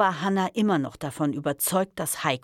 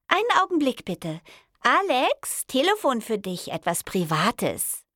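high sing-out to a colleague; female receptionist; 8 semitones higher, 3 dB louder than usual. A narrator's reading. Intern calling Alex to the phone, high female voice. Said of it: "Wieder das glockenhelle Lachen".

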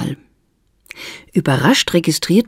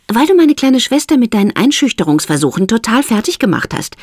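Esther, accusing; 8 semitones higher than usual. A narrator's reading.

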